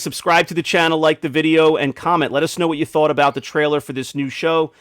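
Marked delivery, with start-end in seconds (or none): none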